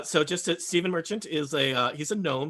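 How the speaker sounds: usual speaking voice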